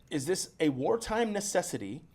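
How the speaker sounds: ordinary delivery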